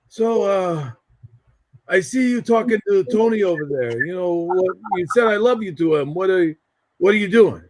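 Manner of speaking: an imitated uncle's put-on voice, sizing someone up; Uncle Freddie. Uncle questioning him at the wedding reception, imitated put-on voice. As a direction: do an impression of an uncle questioning a young relative. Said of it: "this is how he talked".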